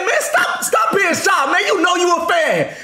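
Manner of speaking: high-pitched, laughing mock shout; teasing coworker. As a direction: egg her on in a high, drawn-out holler. Acts out coworker teasing the woman loudly.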